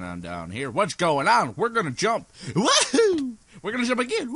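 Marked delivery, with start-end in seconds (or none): 2.5-3.3 high, loud "woohoo"; gleeful falsetto whoop. Real, not acted. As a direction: whoop as you leap. Jumping in the game.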